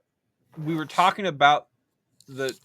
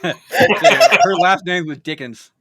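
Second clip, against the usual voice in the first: laughing; 2 semitones higher, 9 dB louder, much slower than usual. Real, not acted. Laughing while telling the name.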